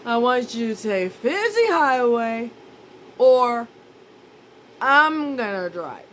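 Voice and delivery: woozy threat to take the wheel; stoned ultimatum. Retold or imitated. High self giving an ultimatum.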